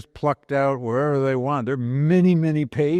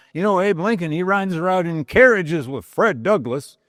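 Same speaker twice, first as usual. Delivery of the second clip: higher, louder sneering stump-speech voice; mocking Stephen Douglas; 7 semitones higher, 4 dB louder than usual. Douglas taunting Lincoln before the crowd, mock sneering voice. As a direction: play Douglas jeering at his rival, pitched up. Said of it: "he kept spinning these yarns".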